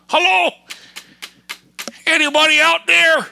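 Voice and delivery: loud, high call into the void; sarcastic hello into emptiness; mock calling out. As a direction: shout as if nobody's listening. Calling out mockingly to nobody.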